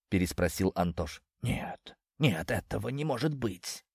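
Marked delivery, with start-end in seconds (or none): 1.4-3.8 breathless, hushed denial; barely voiced, can't believe it; stunned whisper. A narrator's reading. Snake hears he will die, whispering denial. Snake learns of coming death.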